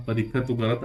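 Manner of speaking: usual speaking voice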